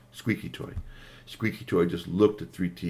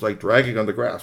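plain reading voice

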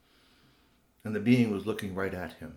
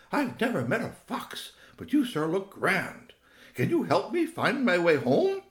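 lightly voiced owlet, raised pitch; a hopeful little owl; baby owl; 9 semitones higher, 5 dB louder than usual. Owlet asks the grand fox for help, lightly high-voiced.

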